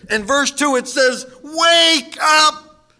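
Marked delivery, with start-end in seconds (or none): 1.4-2.9 loud, high cry of wake up; preacher's shout; shouted command. Acted. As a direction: rouse a sleeping church. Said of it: "Exclamation point".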